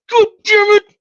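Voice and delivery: high, loud mock outburst of frustration; exasperated fan cursing; frustrated groan. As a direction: blurt it out like a sore loser. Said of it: "everybody's like".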